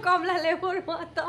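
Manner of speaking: laughing through the words, slow. Real, not acted. Joking to viewers about her swollen face, laughing slowly. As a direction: giggle out the last words at yourself.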